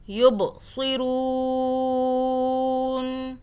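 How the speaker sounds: یبصرون chanted very slowly, ب bounced with a jolt, رو held long; qalqala recitation. Shown as a demonstration. Teacher recites with qalqala for students. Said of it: "با پہ قلقلہ کرنا ہے".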